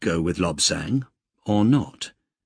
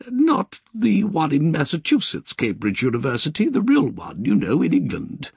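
high, refined English lady's voice; prim British nun, gently lilting; Sister Georgina's polite English accent; 8 semitones higher than usual. Acted. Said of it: "as she put it".

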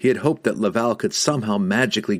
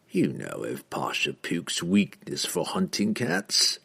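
slow, soft, sly assassin voice; softened, with a grin; 2 semitones lower, 5 dB quieter, slower than usual. A narrator's reading. Assassin coaxing the wizard, slow and softened with a grin. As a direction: ease off into a quiet, knowing grin. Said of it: "Entreri softened his glare and grinned".

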